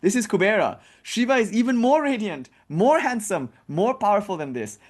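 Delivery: high, insistent correction; troublemaker Narada. Retold or imitated. Troublemaker telling mother it's the wrong god, high and insistent. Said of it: "eternal troublemaker".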